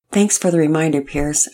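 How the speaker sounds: ordinary delivery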